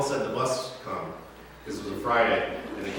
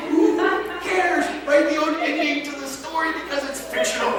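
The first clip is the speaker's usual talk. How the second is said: much higher, louder, slow exasperated mock lecture; exasperated rant; 4 dB louder than usual. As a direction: scold the angry fans, laughing in disbelief. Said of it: "I just wanted to be like".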